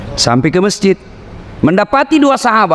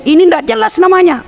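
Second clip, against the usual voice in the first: scolding; 10 semitones higher than usual. Real, not acted. Preacher scolding mixed greetings.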